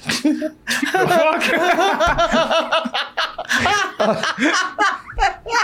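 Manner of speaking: long high-pitched cackle; high laughing fit. Real, not acted. Streamer cracking up at chat, high cackle. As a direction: break into a shrieking laugh.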